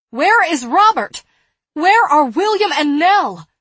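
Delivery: high, loud panicked calls; frantic shouting. Acted. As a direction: shout in desperate fear. Frantic search for missing children.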